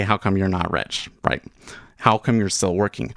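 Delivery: ordinary delivery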